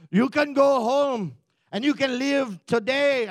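put-on loud, muddled-accent battle speech; rally the troops in a bad put-on accent; William Wallace. Joshua rallying his army, hammed-up William Wallace oratory. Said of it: "I don't even know what accent this is".

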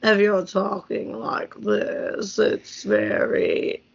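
slow, dragging audiobook monotone; boring old narrator. Shown as a demonstration. Reader imitating War and Peace's boring narrator, slow drone. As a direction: drone it out word by word, painfully slowly. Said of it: "you just can't talk like that".

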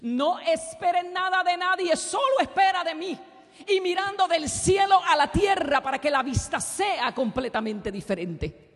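high, loud, slow full-force proclamation; No esperes nada de nadie shouted high and slow; preaching cry. Acted. (No esperes nada de nadie, gritado y agudo)

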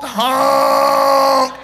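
long loud honk held out; drawn-out honking noise; imitated car horn. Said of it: "five-second honk".

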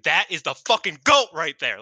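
loud, high hyped-up yell; excited shout. Retold or imitated. Fan to podcast co-host, shouting hyped praise for Utami. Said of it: "And I was like".

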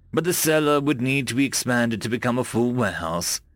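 ordinary delivery

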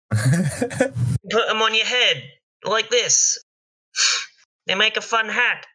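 high, laughing goblin seller. Acted. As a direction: giggle while pitching the hat. Goblin selling underpants.

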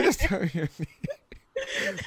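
high, slow, laughing plea; mock pleading. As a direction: beg in a hammed-up near-cry. Begging for name, acted out, laughing.

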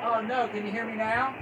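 faint voice, like in a cave; distant and barely audible on the line; far from mic. Real, not acted. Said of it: "sounds like you're far away in a cave".

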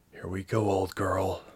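put-on hushed word to an old companion; whispered. A narrator's reading. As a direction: murmur it gently to the machine. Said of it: "she whispered to the Blossom".

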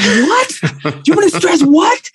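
high, baffled self-imitation; mock confused actor. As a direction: play yourself confused by the note. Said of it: "It's like".

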